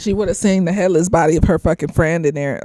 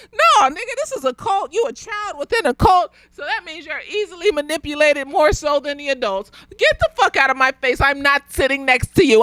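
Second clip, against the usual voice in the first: heated rant; 5 semitones higher than usual. Real, not acted. Ranting at cult child.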